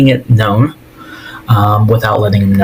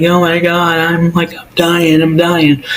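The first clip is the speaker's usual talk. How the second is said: hammed-up "hurt victim" whine; imitated crying; 7 semitones higher than usual. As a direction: imitate a whiny, tearful panic in a high pitch. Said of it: "she's crying. She's like".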